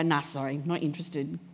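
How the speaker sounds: low, soft offhand refusal; dismissive. Acted. Acquaintance turns down talk of Jesus, low and dismissive. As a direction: brush it off quietly. Voicing people turning down talk.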